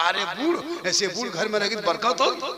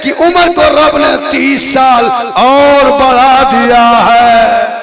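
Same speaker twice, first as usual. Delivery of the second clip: full-force preaching; 4 semitones higher, 14 dB louder than usual. Acted. Preacher telling the mother's-prayer miracle to the congregation, full-force preaching. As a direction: belt the holy story out to the crowd.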